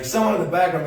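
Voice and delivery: ordinary talk, nothing marked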